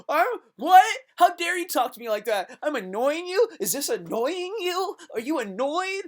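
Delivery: very high, loud, hammed-up indignation; mock outrage. Annoyer confronted about being annoying, mock outrage. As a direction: shriek it like an absurdly offended diva. Imagined outraged reply to complaint.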